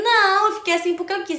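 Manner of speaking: put-on high, loud, defiant little-girl retort; mock angry child. Child answers mother after the show, mock defiant. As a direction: imitate a stubborn child protesting loudly. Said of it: "eu só tava com raiva".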